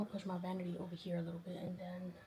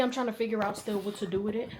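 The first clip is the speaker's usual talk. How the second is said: ordinary talk, nothing marked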